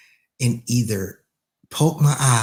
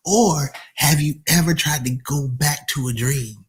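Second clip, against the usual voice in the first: ordinary talk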